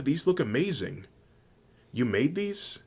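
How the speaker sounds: high, amazed character voice; high rising wonder; delighted thanks. A narrator's reading. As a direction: gush over a gift, pitched high.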